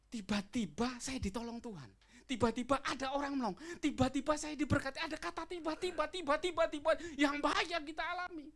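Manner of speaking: high, loud pulpit exhortation; full-force preaching. Acted. (berkhotbah lantang dan berapi-api)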